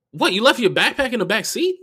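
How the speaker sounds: high, loud disbelief; incredulous. Retold or imitated. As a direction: question him in disbelief. Retelling his incredulous reaction.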